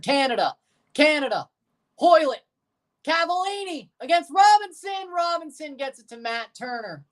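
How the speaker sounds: live commentator getting carried up in pitch; excited play-by-play. Real, not acted. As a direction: build urgency as the attack unfolds, name by name. Calling a live attack.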